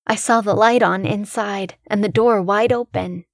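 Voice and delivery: plain reading voice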